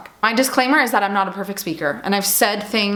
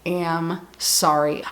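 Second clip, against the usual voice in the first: sincere apology; 3 semitones lower, much slower than usual. Real, not acted. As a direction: lay each word down gently. Apology to hurt listeners.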